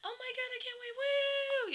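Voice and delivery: very high-pitched squealing friend; imitated giddy high squeal; Kayla, excited. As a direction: squeal with anticipation, ending in a loud whoop. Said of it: "my friend Kayla is like".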